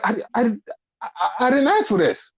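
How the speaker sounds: high, stammering objection; flustered protest. Retold or imitated. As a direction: stumble over the words, baffled. Voices flustered Rocky protesting.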